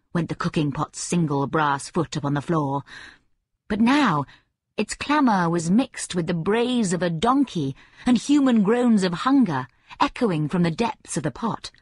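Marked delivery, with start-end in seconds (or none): none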